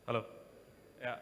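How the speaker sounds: normal talking voice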